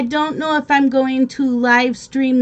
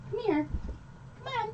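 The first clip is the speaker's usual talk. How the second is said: high, much softer coaxing come here; 11 semitones higher, 15 dB quieter, much faster than usual. Real, not acted. Streamer coaxes the nervous dog closer, soft and high. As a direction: coax her in gently, voice up and soft.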